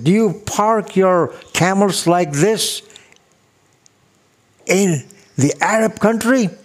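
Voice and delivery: sarcastic judge. Retold or imitated. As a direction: sneer it like a snide judge. Sarcastic judge mocking defendant.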